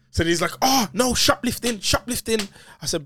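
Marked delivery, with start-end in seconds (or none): 0.6-2.4 higher-pitched imitation of an accuser; mock accusation. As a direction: imitate an alarmed shopkeeper, pitched up. Shop man's accusation, acted out.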